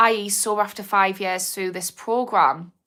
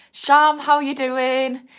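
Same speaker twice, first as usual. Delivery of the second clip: slow, stretched, higher and louder hello; drawn-out greeting; 6 semitones higher, 5 dB louder, much slower than usual. Real, not acted. Greeting a viewer named Sham in chat, stretched-out hello. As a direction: sing out "Sham" long and bright.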